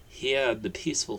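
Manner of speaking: ordinary delivery, nothing marked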